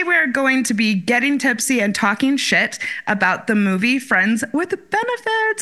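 usual speaking voice

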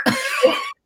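a sudden high laugh bursts out; squealing laugh at the friend's reply; high burst of laughter. Real, not acted.

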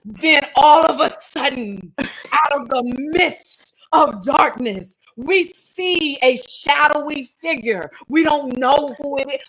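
slow, hushed movie-trailer narration; dramatic storyteller. Shown as a demonstration. Host mocking how she'd write the Bible scene, slow and dramatic. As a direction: build suspense like a thriller opening. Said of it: "that thing would have been extra and dramatic".

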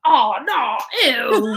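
high, loud mock-disgusted "oh no, ew". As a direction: squeal it like the Romans just found women at the orgy. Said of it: "they're like".

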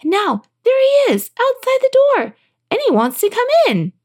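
very high, distressed wail; princess crying out. A narrator's reading. Princess tells father frog is outside, high distressed cry. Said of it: "she cried".

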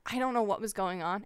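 ordinary delivery

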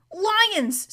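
high urgent cry of alarm; alarmed shout. A narrator's reading. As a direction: cry out the warning. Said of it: "without checking his pace".

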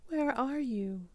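put-on high squeaky baby bat calling out; lost little bat squeaking for her mother; squeaked. A narrator's reading. Baby bat lost in the branches, calling her mother: high squeak. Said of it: "Stella Luna squeaked".

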